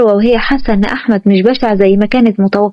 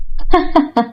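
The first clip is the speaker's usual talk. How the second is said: amused laugh; 4 semitones higher than usual. Acted. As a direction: laugh at his obviousness. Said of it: "ضحكت مروة وقالت".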